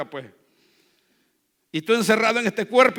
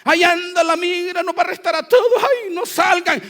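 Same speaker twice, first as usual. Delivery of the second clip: mock panic; 10 semitones higher, 6 dB louder than usual. People panicking about immigration raids.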